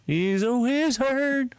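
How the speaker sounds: high, slow, hammed-up proclamation; attempted wizard-film voice. Streamer quotes a wizard line at chat, hammed-up. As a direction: stretch it out in a put-on voice. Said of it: "I don't think I did the voice".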